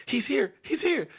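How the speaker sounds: high, urgent lookout call; mock panic he's here; excited alert. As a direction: sound the alarm he's back. Sister excitedly announcing grandpa.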